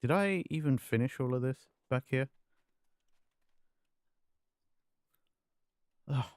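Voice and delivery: panting after squats; breathless, catching air between words; out of breath. Real, not acted. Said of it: "I'm actually out of breath".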